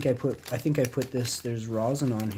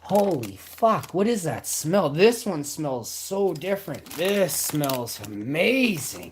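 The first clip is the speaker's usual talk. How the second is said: slow, higher, louder amazement; amazed; 5 semitones higher, 3 dB louder, slower than usual. Real, not acted. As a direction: marvel at the smell, drawing it out. Amazed by a new smell.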